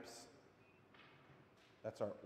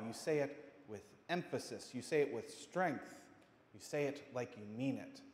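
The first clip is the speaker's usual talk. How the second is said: ordinary talk, nothing marked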